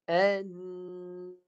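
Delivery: An-nas recited with the sin stopped, no vowel after it; teacher chants An-nas slowly with a clear still sin; recited demo. Shown as a demonstration. Teacher showing a student the dead sin, recited slowly. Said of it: "sin yang mati dengan saya baca".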